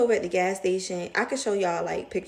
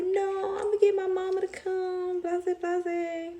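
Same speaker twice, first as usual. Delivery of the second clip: high, put-on brushing-off voice; mock dismissive; 12 semitones higher, slower than usual. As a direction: toss it off in a high mocking voice. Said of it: "'cause I was mad".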